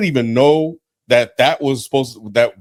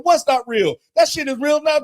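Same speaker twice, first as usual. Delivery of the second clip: exasperated outburst; 12 semitones higher than usual. Retold or imitated. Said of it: "I'm like".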